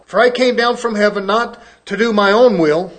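booming pulpit scripture reading. Acted. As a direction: proclaim the verses like a preacher.

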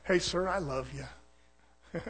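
preacher answering the angry man, soft and slow; soft loving reply. Retold or imitated. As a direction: say it gently to calm him down.

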